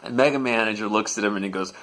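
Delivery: ordinary delivery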